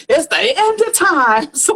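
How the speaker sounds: slow, drawn-out mock elder warning; lightly mocking old head voice; imitating the old heads. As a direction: stretch it out like an old-timer preaching doom. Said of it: "the old heads say".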